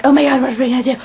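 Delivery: mock panicked babble; spazzing out. Imitating Reed spazzing over the binder in the elevator, mock panic. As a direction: rattle it out in an exaggerated fluster. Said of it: "he's totally spazzing out".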